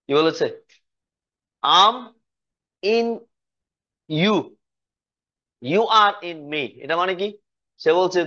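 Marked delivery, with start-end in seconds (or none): 1.6-6.6 slow drill. Shown as a demonstration. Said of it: "teacher drills the line slowly".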